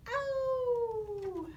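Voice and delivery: high ow. Shown as a demonstration. Teacher modelling the ow sound for kids. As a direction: yelp the ow up high. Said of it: "let me hear you ow".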